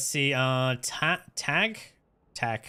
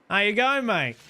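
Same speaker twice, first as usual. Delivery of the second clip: put-on Aussie accent; 5 semitones higher than usual. Streamer testing if he passes as an Aussie, in a mock Australian accent. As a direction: go for the classic Aussie hello. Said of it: "Would I pass, uh, as an, uh, an Aussie".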